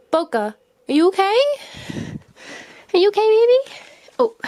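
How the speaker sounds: high worried cooing. Real, not acted. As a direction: coo anxiously at a small pet. Worried cooing to pet rat.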